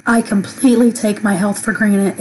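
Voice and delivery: normal talking voice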